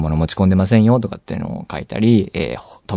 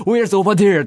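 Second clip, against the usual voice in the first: imitating a stern officer, higher; harsh tone; 9 semitones higher, slower than usual. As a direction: snap the question back in mock annoyance. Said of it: "すごいきつい口調で言われましてね". (きつい口調で問い詰める真似)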